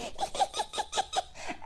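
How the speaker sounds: tiny squeaky titter; mouse laugh. Acted. Field mouse laughing at Toad. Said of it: "The field mouse laughed".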